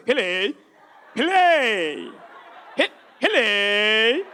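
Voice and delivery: put-on loud accented hele, pitch bouncing on each try; hello in varied tones. Dad shouting hello down a bad line in varied tones. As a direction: drag the last hello out slow and rising. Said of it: "doing it in seven different tones".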